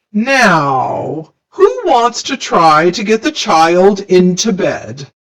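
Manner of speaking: monster teacher. A narrator's reading. Mister Z character voice.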